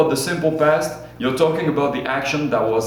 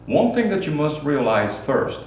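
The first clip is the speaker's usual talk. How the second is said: ordinary talk, nothing marked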